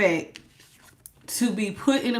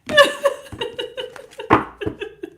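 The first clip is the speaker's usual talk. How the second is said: breathy wheezy laugh. Real, not acted. Laughs after greeting viewer.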